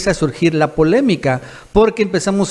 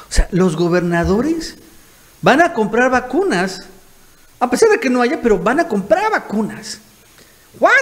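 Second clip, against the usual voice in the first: higher-pitched, incredulous questioning; mock disbelief; 5 semitones higher than usual. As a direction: act baffled by the plan. Mock disbelief at governors' plan.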